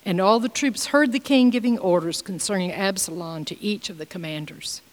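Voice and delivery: ordinary talk, nothing marked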